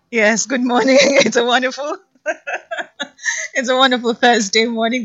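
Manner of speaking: loud, laughing good-morning greeting; beaming and laughing on air. Real, not acted. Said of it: "hear the smile in my voice".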